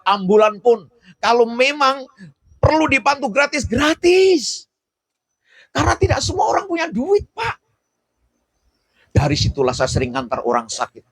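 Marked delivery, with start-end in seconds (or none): 0.1-7.6 climbing to a high, insistent cry; impassioned preaching. Real, not acted. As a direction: build the appeal higher and higher for the congregation. Preaches free help at full force.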